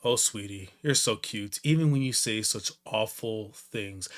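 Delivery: soft, low and slowing endearment; mock-tender mother. Mother answering her son's awful words, softly tender. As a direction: coo it softly and low to a child. Voicing the mother's reply to son.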